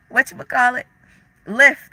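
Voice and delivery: giggling through the word; amused at herself for forgetting; can't find the app's name, laughing. Real, not acted. Laughs while fumbling for the word.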